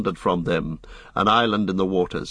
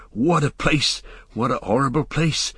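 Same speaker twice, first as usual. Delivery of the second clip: dismayed groan in character; 4 semitones higher than usual. A narrator's reading. Sam crouched in the boat between dreadful cliffs, groaning. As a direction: moan it as a put-upon companion. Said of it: "heard Sam in front muttering and groaning".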